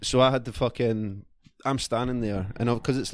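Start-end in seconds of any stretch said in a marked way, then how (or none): none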